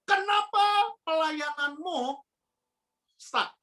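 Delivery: rising pleading preacher questions; fervent youth-pastor preaching. Acted. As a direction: drive each why home to the youth.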